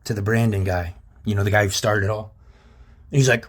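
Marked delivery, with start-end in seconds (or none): none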